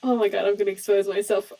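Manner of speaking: normal talking voice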